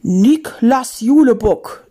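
loud, slow menacing summons; drawn-out thunderous call; booming voice. Acted. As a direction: boom the name out slowly. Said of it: "dröhnte eine Stimme".

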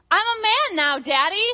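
put-on loud boyish announcement. Little boy telling Daddy in a stadium restroom, loud proud child shout. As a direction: announce it to the whole bathroom. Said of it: "informed my husband at a high decibel".

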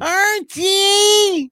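very high, loud nasal call of Archie; shrill sitcom wife. Retold or imitated. Host mocks the wife's voice to tease co-host: high, loud call. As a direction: yell his name like the annoying TV wife. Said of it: "His wife's voice is just totally annoying".

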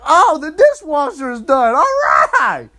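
high, loud excited cheer; sudden delight. Retold or imitated. Women hearing the dishwasher is done, mock-excited cheer. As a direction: switch to gleeful excitement.

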